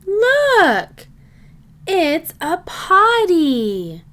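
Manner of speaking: usual speaking voice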